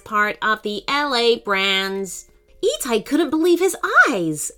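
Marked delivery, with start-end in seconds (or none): 0.0-2.3 monotone machine voice, every word even; flat electronic robot. Acted. Robot introducing itself to the team, flat electronic voice. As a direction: talk like a robot with no inflection. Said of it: "said the robot in a flat electronic voice".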